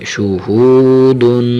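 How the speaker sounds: شہودن with a thick ہ and the و stretched two or three beats; lengthened vowel. Shown as a demonstration. Teacher stretching the و of شہودن for the pupil. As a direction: pull out the و in شہودن. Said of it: "واو کے ساتھ تھوڑا سا کھینچیں".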